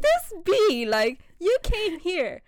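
high, loud indignation; scandalised, voice shooting up; exasperated outrage. Retold or imitated. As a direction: call her out in high-pitched disbelief. Retold outraged reaction to Bella.

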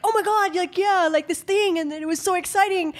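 high, fast gushing imitation; high-pitched hammed-up enthusiasm; mock super loud excited talk. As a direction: act out his loud excited chatter. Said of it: "like, super loud".

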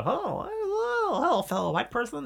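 very high, drawn-out hello; mock-polite greeting. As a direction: sing out a silly, slow greeting. Mock-polite greeting acted in a story.

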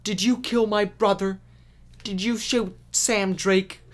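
high, loud, overwrought mock accusation; hammed-up dramatic line reading; badly acted confrontation. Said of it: "really poor delivery consistently".

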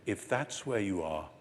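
soft, gentle reassurance from Jesus. Retold or imitated. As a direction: reassure Peter softly. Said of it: "what Jesus is saying is".

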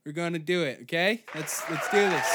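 ordinary talk

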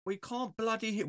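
a lightly put-on English accent. Host teasing guest about muted mic, slow mock English accent. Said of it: "Why am I doing an English accent?".

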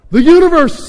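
high, loud cry of awe; excited proclamation. Acted. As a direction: call it out grandly. Lun Kuo joins the proclamation.

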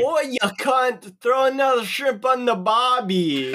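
put-on Australian accent. Player tries talking to giant spiders, bad Australian accent. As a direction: call out in a hammed-up, Irish-tinged Australian accent. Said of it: "talk to them in Australian".

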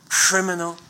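ordinary talk

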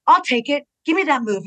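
high, loud, grabby demand; a lightly voiced excited fan calling dibs; mock eager fan. Ruby voiced claiming a move.

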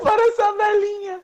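slow, squeaky high voice; chipmunk voice. Pretend. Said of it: "bora encerrar com a voz fina".

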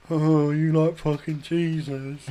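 slow, drawn-out sibling teasing; imitating a jeering sister; sister's mocking taunt. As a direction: stretch it out to ridicule him. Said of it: "laughing at me and that".

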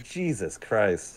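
very slow, quiet, breathed-out exasperation; stunned disbelief. Retold or imitated. Player recalls a game glitch to friends, slow and stunned. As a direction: drag it out like you can't believe it. Car glitch sends him flying.

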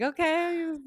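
very high, squeaky okay; retold high-voiced reply; awkward okay. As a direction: pitch it way up, awkwardly.

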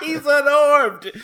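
high, slow, laughing voice; laughing mock protest; mock bystander. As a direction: play it up with a laugh. Imitating the filming guy.

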